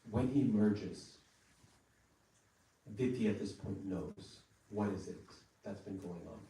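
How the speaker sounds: usual speaking voice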